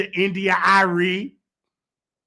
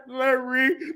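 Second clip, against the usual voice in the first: ordinary talk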